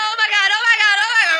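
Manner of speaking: high, loud panic; panicked shout. Acted. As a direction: yell it in sudden terror. Panics at a strange noise.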